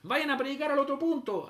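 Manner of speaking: high, loud imitated PA order; mock public-address shout; loudspeaker call. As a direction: shoo them off as if over a loudspeaker. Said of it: "por harto parlante nos hablaban".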